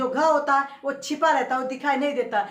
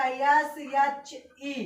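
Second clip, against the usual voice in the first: slow spelling; much slower than usual. Shown as a demonstration. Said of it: "तो हमने लिखा".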